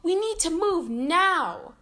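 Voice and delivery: loud, high, slowed urgent call. A narrator's reading. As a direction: hurry everyone out. Urgent escape from lava.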